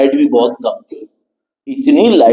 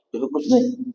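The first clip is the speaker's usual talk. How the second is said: high, quick brush-off; mock evasive reply; 10 semitones higher, 9 dB quieter than usual. Imitating the servant dodging the question, high brush-off. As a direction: shrug it off as nothing.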